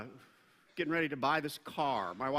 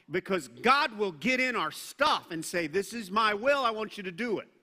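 much louder, forceful preacher's warning; aggressive preaching; 4 semitones higher, 5 dB louder than usual. Real, not acted. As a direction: hammer the warning home to the congregation. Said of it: "It was kind of aggressive".

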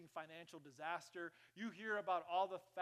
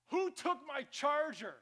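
slow, loud, higher accusing demand; wife's demand; 8 semitones higher, 10 dB louder, slower than usual. Retold or imitated. Pastor imitating his wife hunting her charger, angry. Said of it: "if you hear my wife say".

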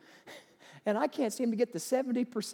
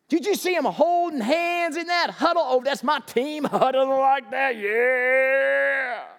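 mock adoring fan; 3 semitones higher, 5 dB louder than usual. Preacher mocking fans who cheer a huddle, hammed-up gushing. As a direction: ham up a fan swooning at a mere huddle.

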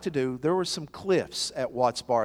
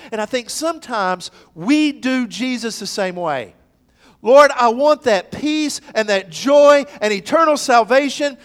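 loud, high, driving sermon voice; fervent pulpit delivery; full-force preaching; 7 semitones higher, 12 dB louder than usual. Acted. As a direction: preach it out to the back pew. Preaching to congregation.

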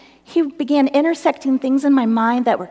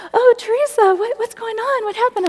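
high-pitched, alarmed sympathy; acted gush of worried concern; concerned friend; 11 semitones higher, 4 dB louder than usual. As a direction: imitate a friend fussing over someone in tears. Friend reacting to her crying.

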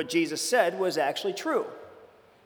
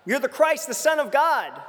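eager declaration; 8 semitones higher than usual. Retold or imitated. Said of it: "Peter speaks right up".

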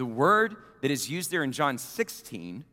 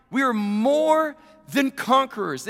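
"We are more than conquerors" rung out louder and higher, MORE hit hard; preached refrain; 8 semitones higher, 5 dB louder than usual. Acted. Repeated refrain, loud take.

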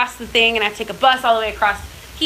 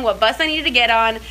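ordinary delivery

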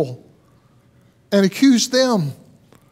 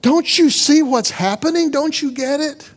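higher, urgent pleading; lightly voiced creation, insistent; mock shout; 7 semitones higher than usual. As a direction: creation urging the crowd to wake up. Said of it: "way of shouting and saying".

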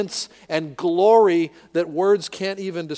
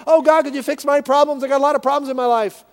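much higher, louder, fast whining request; mock whiny petitioner; 10 semitones higher, 7 dB louder, faster than usual. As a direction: parody a selfish complaint prayer. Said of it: "Does he say".

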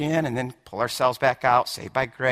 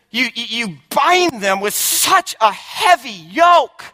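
1 shouted rebuke; 8 semitones higher, 7 dB louder, slower than usual. Acted. Shouted quote of Jesus's rebuke.

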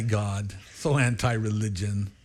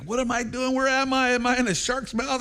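much higher, frantic questions; panicked diver; 11 semitones higher than usual. Retold or imitated. Diver inside the whale's mouth, much higher and panicked. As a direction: sound lost in the dark, panicking. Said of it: "He's like".

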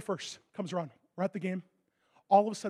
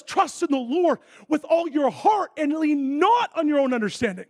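slower, higher and louder; scripture quote; 6 semitones higher, 6 dB louder than usual. Acted. Preacher quoting Proverbs to the congregation, intoned. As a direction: intone the verse.

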